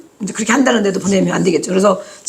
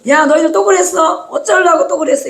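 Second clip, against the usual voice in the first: mock rebuke; 7 semitones higher than usual. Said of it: "책망하겠네".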